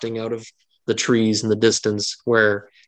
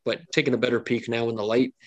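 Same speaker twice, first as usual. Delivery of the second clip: normal talking voice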